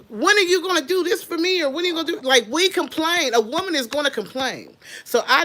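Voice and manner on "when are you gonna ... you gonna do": mock complaining wife. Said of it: "she'll say something like".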